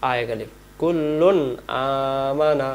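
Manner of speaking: normal talking voice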